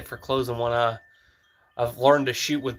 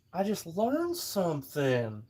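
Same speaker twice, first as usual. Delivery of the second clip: drawn-out, slow and quieter; slow soft repeat; 3 semitones higher, 6 dB quieter, slower than usual. Shown as a demonstration. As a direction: say it slowly, like reciting something new. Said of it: "Let's see if I can repeat it".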